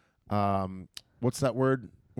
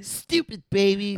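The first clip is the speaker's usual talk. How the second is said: high, slow bratty sneer; Angelica voice; 9 semitones higher, 4 dB louder, slower than usual. Retold or imitated. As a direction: drawl it like a spoiled kid. Quoting Angelica insult.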